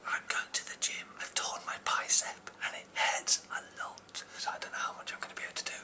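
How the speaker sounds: hushed unvoiced whisper to camera. Real, not acted. Vlogger greets viewers while Susanna is asleep, whispering. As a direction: whisper so as not to wake a sleeper.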